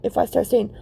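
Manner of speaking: normal talking voice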